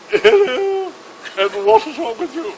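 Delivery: hammed-up high-pitched royal greeting. The Queen visits the same chap's bed, in a high, grander regal voice. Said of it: "more regal".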